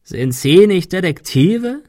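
slow, high croak rising into a question. A narrator's reading. Gunman mocks the detectives, croaking. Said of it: "krächzte er".

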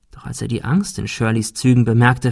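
usual speaking voice

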